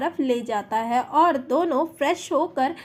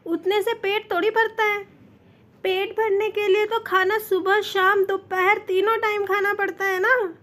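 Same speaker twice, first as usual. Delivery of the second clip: higher, pouty playful whine; pouting; 5 semitones higher than usual. A narrator's reading. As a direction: sulk it out with a pout. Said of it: "Jungkook pout बनाता हुआ कहता है".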